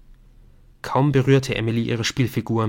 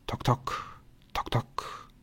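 hushed, voiceless heartbeat ticks; whispered knocking; 9 dB quieter, slower than usual. Acted. Game makes heartbeat knocking. (geflüstertes Klopfen wie ein Herzschlag)

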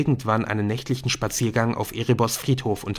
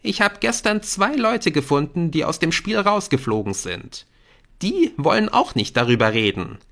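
higher, earnest arguing voice; insistent schoolboy; 9 semitones higher than usual. Acted. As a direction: contradict your friend and press the case. Jamie argues back at Nick. (eindringlich widersprechender Schüler)

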